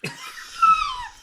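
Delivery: sudden high laugh; loud burst of laughter. Real, not acted.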